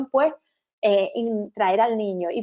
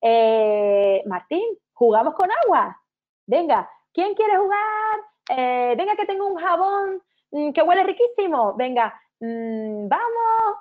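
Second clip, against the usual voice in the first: much higher, playful singsong invitation; playful coaxing; 7 semitones higher, slower than usual. Acted. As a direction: lure the child into the bath like a game. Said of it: "No, al contrario".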